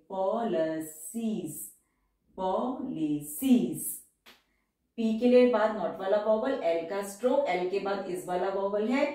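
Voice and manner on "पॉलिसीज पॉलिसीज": Policies stretched very slowly with a long final सीज; slow dictation. Shown as a demonstration. Teacher dictating policies very slowly to the class. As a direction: dictate policies slowly so each sound is heard. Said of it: "उसके लिए हम small circle बनाएंगे".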